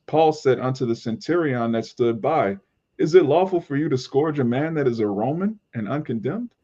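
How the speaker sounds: usual speaking voice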